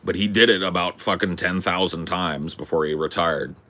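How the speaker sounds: ordinary delivery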